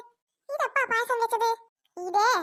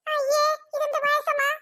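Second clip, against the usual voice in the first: disgusted higher cry; 6 semitones higher, slower than usual. Acted. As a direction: recoil at the taste. Tasting bad payasam.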